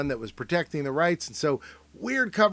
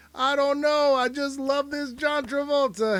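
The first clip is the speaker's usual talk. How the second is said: high goofy voice; 10 semitones higher than usual. Pretend. Host voices the star gushing on the carpet, high and goofy. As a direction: gush about him like a fan. Voicing the photo pair.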